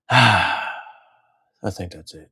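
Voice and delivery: sigh, then very slow and drawn out; slow sighing sign-off. Real, not acted. Wrapping up the video, slow and sighing. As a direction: let it drag out on a sigh.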